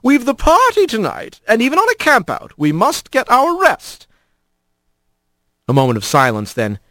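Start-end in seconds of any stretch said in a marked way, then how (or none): 0.0-4.1 put-on higher old lady voice, gently firm; grandma opossum. A narrator's reading. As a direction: settle the children like a patient grandmother. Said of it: "Miz Hambaka said".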